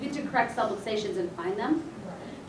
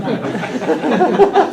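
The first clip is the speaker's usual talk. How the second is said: loud laugh; 10 dB louder than usual. Real, not acted. Laughing at her own joke.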